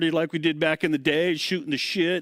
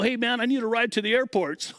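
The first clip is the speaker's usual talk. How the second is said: calling back; 9 semitones higher than usual. Retold or imitated. Calling back across the street.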